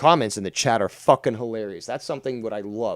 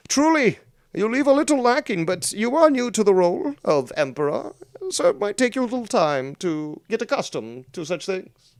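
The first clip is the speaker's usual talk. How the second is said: high, loud, lofty and condescending; pompous emperor voice; 14 semitones higher, 6 dB louder than usual. Acted. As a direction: lecture like an arrogant emperor. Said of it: "then I can go like this".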